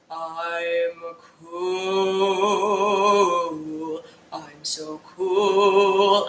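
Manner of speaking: deep, slow macho voice; manly voice. Shown as a demonstration. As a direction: drop low and drawl it out, tough guy. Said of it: "in a manly voice".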